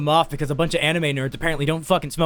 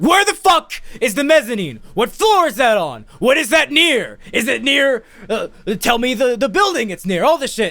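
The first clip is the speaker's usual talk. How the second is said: loud, high, frustrated questioning; exasperated retelling; 7 semitones higher, 4 dB louder than usual. Retold or imitated. Lost caller demands directions to the mezzanine, exasperated. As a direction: hammer out each question in rising exasperation.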